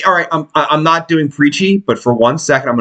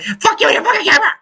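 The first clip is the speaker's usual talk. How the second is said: mock scream, much higher and fast; imitating a screaming woman; much faster than usual. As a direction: shriek it like a witch at the camera. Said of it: "she's screaming into the camera".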